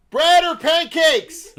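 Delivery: high, loud, slow, laughing; slow shouted repeat. Retold or imitated. To hard-of-hearing grandpa, slow laughing shout. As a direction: shout it slowly into his ear. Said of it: "I have to do this".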